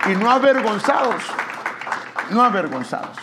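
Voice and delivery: rising, driving sermon voice; fervent preaching. Acted. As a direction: preach it out to the brothers. Preaching at full force. (predicación ferviente y en aumento)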